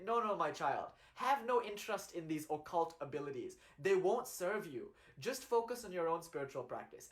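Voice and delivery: ordinary delivery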